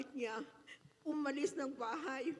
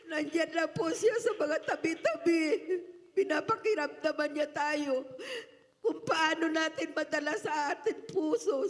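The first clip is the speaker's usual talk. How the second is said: loud, emotional, laughing testimony; laughing through tears; 2 semitones higher, 6 dB louder than usual. Real, not acted. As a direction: voice breaking with joy.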